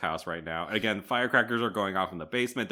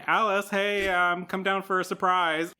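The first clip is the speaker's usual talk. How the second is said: high, louder sing-out to someone upstairs; calling out; 9 semitones higher, 3 dB louder than usual. Retold or imitated. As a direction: call them down in a raised voice. Family calls Alice downstairs.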